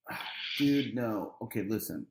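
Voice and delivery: soft, low, reasoning refusal. Retold or imitated. Trying to reason with the guy in the woods, soft low refusal. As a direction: turn him down gently and quietly. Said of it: "the voice of reason".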